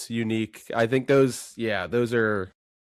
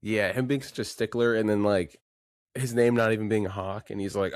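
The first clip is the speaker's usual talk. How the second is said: ordinary delivery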